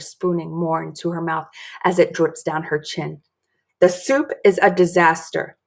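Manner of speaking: ordinary delivery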